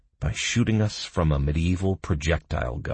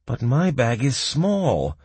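high, slow whine of complaint; aggrieved, pitch raised; bitter complaint; 8 semitones higher, 4 dB louder, slower than usual. A narrator's reading. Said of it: "Bum bitterly complained".

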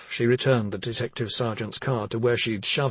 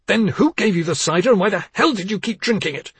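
angry accusation; 9 semitones higher, 7 dB louder than usual. Acted. Angry father interrogates.

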